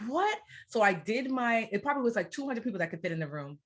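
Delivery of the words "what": high, rising "what?"; the penny hasn't dropped yet; a sharp, high, baffled "what?"; shocked what. Retold or imitated. Shocked at the packed room.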